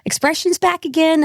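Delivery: high, lightly mocked American lilt; Americanised Irish accent. Passenger asking help with his expressions, light American accent. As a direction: imitate a returning emigrant's American accent. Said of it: "You sound like an American prick".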